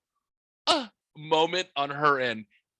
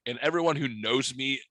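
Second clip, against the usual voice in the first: normal talking voice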